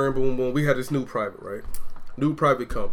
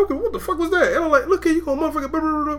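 mock outrage; 12 semitones higher, faster than usual. Replays his chewing-out of private.

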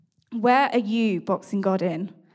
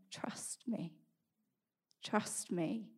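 a much softer whispered echo; whispered divine voice; 14 dB quieter, much slower than usual. Retold or imitated. God's voice heard through a painful week, whispered. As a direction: whisper it like a voice in the head. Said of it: "the voice saying".

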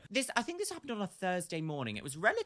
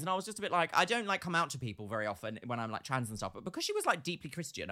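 normal talking voice

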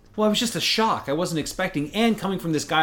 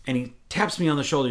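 ordinary talk, nothing marked

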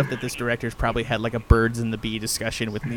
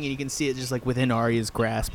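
normal talking voice

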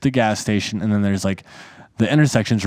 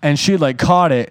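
normal talking voice

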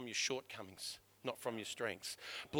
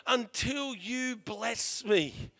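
loud, very slow insistent shout; determined shout; 8 semitones higher, 8 dB louder, much slower than usual. Retold or imitated. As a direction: refuse to let go, shout it out. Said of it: "wrestled God all night and said".

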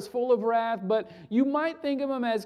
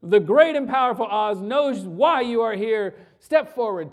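thundering wizard; 6 dB louder than usual. Acted. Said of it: "cuts her off and says".

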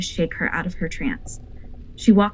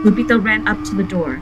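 ordinary delivery

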